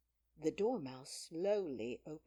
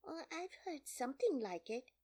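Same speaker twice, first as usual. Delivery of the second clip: put-on higher voice for young Alice; Alice, lightly voiced girl; 9 semitones higher than usual. A narrator's reading.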